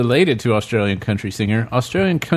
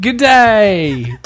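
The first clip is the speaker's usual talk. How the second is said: mock Australian; 7 semitones higher, 5 dB louder than usual. Australian banter.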